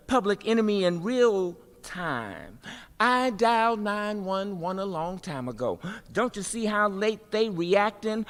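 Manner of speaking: rhythmic performance poetry with big swings; spoken word poem. Acted. Poet performing for a crowd about injustice, rhythmic and rising.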